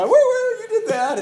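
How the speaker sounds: high, loud, thrilled woo woo; little girl squealing after being startled; excited child. Retold or imitated. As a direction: shriek with glee then tell him off playfully. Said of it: "And she'd go".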